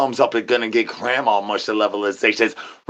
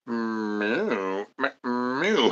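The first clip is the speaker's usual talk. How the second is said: cow moo; 8 dB quieter, much slower than usual. Pretend. Mooing like a cow.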